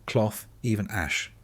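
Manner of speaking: plain reading voice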